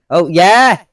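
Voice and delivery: loud high whoop of joy; excited cheer. Real, not acted. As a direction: cheer the plane on. Cheering his plane's flyby.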